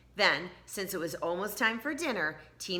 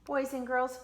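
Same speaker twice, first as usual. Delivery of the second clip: plain reading voice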